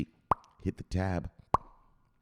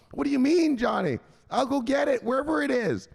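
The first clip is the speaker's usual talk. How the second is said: naive mom; 12 semitones higher, 3 dB louder than usual. Acted. Mom voiced.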